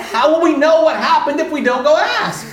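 high, loud comic insistence; insistent exclamation. Retold or imitated. Acting out pushy answer to reluctant friend.

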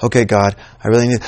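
low, soft, earnest-sounding prayer; hushed imitated prayer. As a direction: start the mock prayer low and subdued. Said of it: "they'll do this".

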